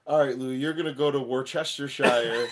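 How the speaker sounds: "Worcestershire" as wor-CHESTER-shire, quoting the boss; amused retelling, "Worcestershire" read as spelt; boss's mispronunciation. Retold or imitated. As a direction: imitate him sounding out "Worcestershire" in full. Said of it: "and he goes".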